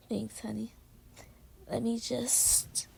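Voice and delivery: soft unvoiced whisper, very slow. Girlfriend thanking her boyfriend as she snuggles in, whispering. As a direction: breathe the words close to his ear.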